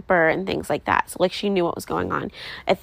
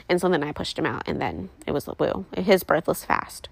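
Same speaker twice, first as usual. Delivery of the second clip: ordinary delivery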